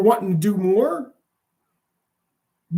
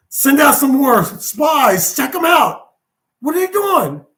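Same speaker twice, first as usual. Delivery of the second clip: raised, high-pitched orders; frantic commander; 4 semitones higher, 4 dB louder than usual. Acted. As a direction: play a rattled commander demanding answers from his spies. Frantic commander ordering more spies.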